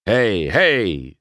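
loud, objecting call; protesting. Acted. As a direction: break in with a firm protest. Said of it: "Sheriff protested".

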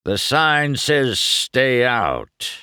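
slow, loud growl; growled warning. A narrator's reading. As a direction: growl it out slowly, warning off an intruder. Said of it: "Doc growled".

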